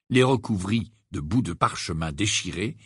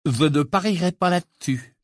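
cutting; 6 semitones higher, 3 dB louder than usual. Acted. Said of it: "d'un ton tranchant".

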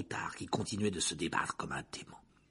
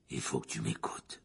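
low, hushed hoarse voice; 12 semitones lower, 4 dB quieter than usual. A narrator's reading. Prisoner begs to be heard, hoarse. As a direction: urge him in a low rasp. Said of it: "dit-il de sa voix rauque".

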